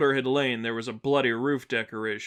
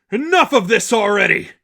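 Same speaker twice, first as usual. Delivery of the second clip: a loud, high, furious shout at his attacker; 9 semitones higher, 11 dB louder than usual. Acted. The Seraphim roaring at Angel mid-fight, furious loud shout. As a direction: bellow it in a rage, pitch up high. Wounded villain lashing out in fight.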